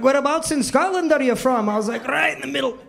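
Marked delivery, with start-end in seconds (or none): 0.0-1.7 higher-pitched imitation of a Scottish accent; lilting Scottish brogue, imitated. Customer quizzes waiter on his hometown, Scottish accent. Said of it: "a really thick Scottish accent". 2.1-2.8 higher, put-on Scottish brogue; fake Scottish accent. Waiter fakes a Scottish hometown, Scottish accent. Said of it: "I had to talk like that".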